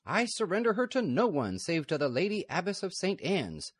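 high, loud firm refusal; firm abbess. Acted. Lady Superior refusing the outlaws, high and firm. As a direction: stand your ground with dignity.

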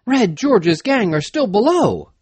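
high-pitched alarmed reply; urgent warning. A narrator's reading. Answering the men on the hill, urgent warning.

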